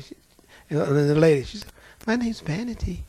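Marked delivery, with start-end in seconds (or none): 2.1-3.0 sweet demure demon. Retold or imitated. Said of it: "She sounded just as sweet and demure".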